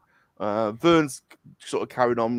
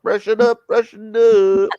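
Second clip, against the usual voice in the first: mock Jamaican accent; 9 semitones higher, 9 dB louder, slower than usual. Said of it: "he's more like got a Jamaican accent".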